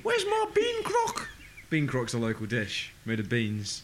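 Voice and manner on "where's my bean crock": very high, slow granny voice; grandma impression. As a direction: drag it out like a querulous mock grandma. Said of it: "doing an impression of my gran".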